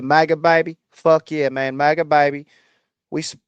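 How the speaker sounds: hammed-up Southern accent; fake Southern accent. Shown as a demonstration. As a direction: lay on a fake Southern MAGA voice. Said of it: "That is a fake accent".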